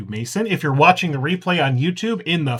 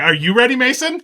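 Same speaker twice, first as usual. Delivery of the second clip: hype call; 9 semitones higher, 8 dB louder than usual. Acted. Hyping up the guest.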